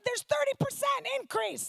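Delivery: comic cheerful stat; squeaky fact. Retold or imitated. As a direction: pipe up brightly. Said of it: "I was like".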